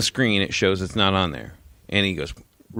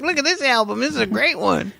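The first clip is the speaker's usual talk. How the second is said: mock excited dad; 13 semitones higher than usual. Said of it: "I hold up a C D and I'm like".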